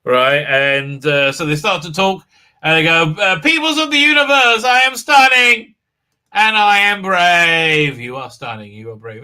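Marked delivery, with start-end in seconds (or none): 3.4-8.0 high, loud, drawn-out self-important speech; mock grand proclamation. 8.0-9.2 low, very soft praise; mock hushed admiration. Admiring reply in acted mutual praise.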